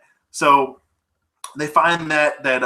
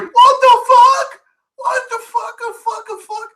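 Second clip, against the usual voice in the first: much higher, mock-stunned squeak, fading off; shocked disbelief; 5 dB louder than usual. Heroes watching their friend get shot, high mock shock. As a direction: gasp it out in high comic disbelief. Stunned group reacting to shooting.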